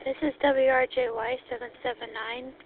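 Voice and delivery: ordinary talk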